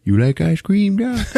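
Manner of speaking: a hint of a friendly old cook's voice; Dick Halloran impression. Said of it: "Just like Dick Halloran".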